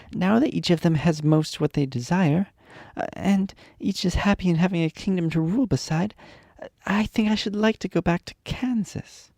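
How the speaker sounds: put-on young Dorothy, wistful about home; Dorothy's voice.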